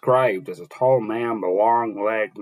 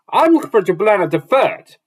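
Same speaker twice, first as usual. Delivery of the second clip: higher, louder dwarf exclaiming; dwarf exclaims up to Rand, higher and louder; 10 semitones higher, 6 dB louder than usual. Acted. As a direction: announce it high and loud as the dwarf. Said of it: "exclaimed the dwarf".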